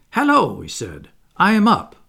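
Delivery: high, loud hello; bright greeting. Acted. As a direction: call out on jumping up. John wakes, announces himself.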